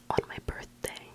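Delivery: usual speaking voice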